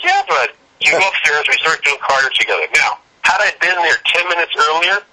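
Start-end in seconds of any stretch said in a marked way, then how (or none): 0.0-0.5 very high, keen reply; eager yes. Retold or imitated. Agreeing to cardio with Ron, eager high reply. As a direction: jump at the offer, pitched way up. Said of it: "I'm like".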